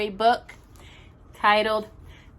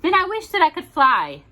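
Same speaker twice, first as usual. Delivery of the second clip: higher, louder eager wish; Little Bear voice; 9 semitones higher, 7 dB louder than usual. Acted. Little Bear tells Mother Bear his wish, eager higher voice. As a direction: wish out loud like a dreamy cub.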